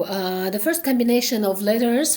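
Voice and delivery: usual speaking voice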